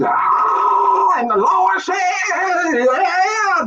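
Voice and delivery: a shouted "Ah" then a high, hammed-up preacher cry; mock preacher. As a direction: ham up a hollering preacher. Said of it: "Y'all want me to preach".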